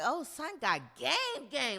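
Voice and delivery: slow, high, drawn-out admiration; impressed. Retold or imitated. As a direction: savor it like a compliment. Impressed at his line.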